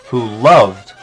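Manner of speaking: normal talking voice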